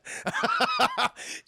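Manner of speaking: laugh. Real, not acted. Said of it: "as I'm gesturing with my head".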